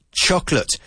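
two-syllable "CHOC-lət"; first-syllable stress. Shown as a demonstration. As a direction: hit the first syllable of Chocolate, drop the second. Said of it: "Emphasizing the first syllable".

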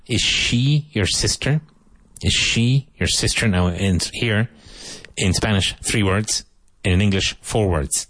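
normal talking voice

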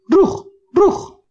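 very high shrieked "Rug! Rug!"; shrieking bark. Acted. Dog Boris charges the intruders, shrieking very high barks. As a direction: shriek the barks, furious and scared. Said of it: "chilló Boris".

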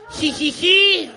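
high, loud mocking "jijiji" giggle; a smug little texting giggle, hammed up. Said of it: "así teclean las mujeres". (risita burlona "jijiji")

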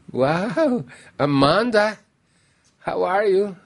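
high, bright welcome for a friend; light up as she walks in; delighted to see Amanda arrive; excited greeting. Real, not acted. Greeting a guest warmly, excited.

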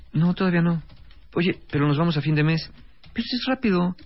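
lightly voiced breezy partner; naive partner voice. Voicing partner's naive replies. (pareja ingenua y confiada)

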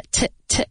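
"t, t" as short tuh with a schwa; soft neutral vowel. Shown as a demonstration. Teacher showing how the o sounds, short weak "t, t". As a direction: barely voice the vowel in "t, t". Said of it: "se pronuncia como si fuera".

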